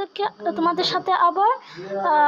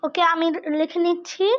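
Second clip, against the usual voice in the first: usual speaking voice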